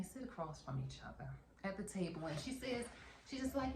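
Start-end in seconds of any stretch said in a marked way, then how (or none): none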